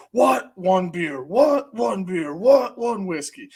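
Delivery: high, loud barked "what?" between the beer calls; rowdy wrestling promo yelling; Stone Cold impression. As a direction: hype the crowd like a wrestler on the mic. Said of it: "I'm going Stone Cold Steve Austin".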